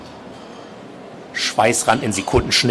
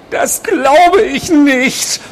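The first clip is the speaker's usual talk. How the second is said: very high, slow, loud protest; almost desperate; 5 dB louder, much slower than usual. A narrator's reading. Patient refusing nurse's word, desperate high drawn-out protest. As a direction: wail it out in drawn-out disbelief. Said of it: "Berger klang fast verzweifelt".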